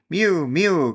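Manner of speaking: high, crying mew; cat mewing. A narrator's reading. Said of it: "cried Simpkin".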